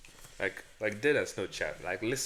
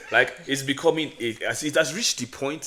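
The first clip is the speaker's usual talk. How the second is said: put-on African accent; 4 semitones higher, 6 dB louder than usual. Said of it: "my African accent is leaving".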